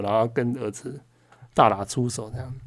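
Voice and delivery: ordinary talk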